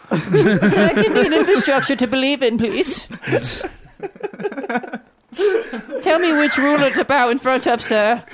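Oliver Twist begging. Acted. Mocking millennials as Oliver Twist, high pleading beggar. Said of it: "millennial Oliver Twist, new character".

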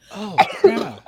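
high, loud delighted cry; excited call. Acted. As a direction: call out to her with joy. Calling out to grandma.

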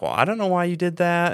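high-pitched, lightly attempted Southern-wife accent imitation; Connie Burton accent. Wife questioning her coach husband, lightly imitated accent. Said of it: "that was my best Connie Burton accent".

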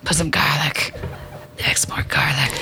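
low, breathy stage whisper; mock spooky whisper. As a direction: whisper it like a creepy old crone. Mock voice of scary old whisperer.

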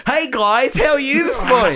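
high-pitched, over-cheery greeting; hammed-up morning cheeriness; chirpy idiot friend. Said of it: "so I'll just come in".

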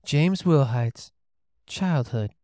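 slow, soothing therapist voice; calm crisis counselor. Pretend. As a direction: ease him gently into his past. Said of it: "you have to use the therapist voice".

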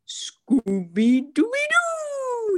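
high, very slow throaty dog voice; Scooby-Doo impression. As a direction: put the throat in it like the cartoon dog. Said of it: "this one's hard because you have to get, like, the throat in it".

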